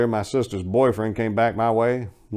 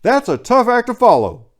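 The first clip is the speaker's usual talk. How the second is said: annoyed; 9 semitones higher, 6 dB louder than usual. A narrator's reading. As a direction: let the irritation show. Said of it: "My annoyance becoming evident".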